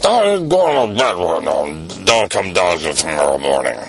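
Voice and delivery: low, slowed father sending him off; stern dad. Acted. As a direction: lay down the law to bed. Dad sends son to bed.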